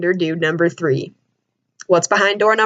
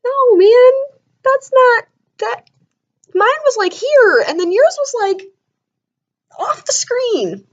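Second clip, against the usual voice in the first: high, loud disbelief; high squeaky disbelieving rant; incredulous; 13 semitones higher, 3 dB louder, slower than usual. Retold or imitated. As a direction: pitch it way up in mock horror. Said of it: "I'm just like".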